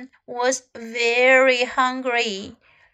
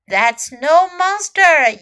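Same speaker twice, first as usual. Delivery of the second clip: loud, slow, emphatic squirrel; yell; 3 semitones higher, 7 dB louder, slower than usual. A narrator's reading. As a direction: yell it slowly as a storybook correction. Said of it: "yelled the squirrel".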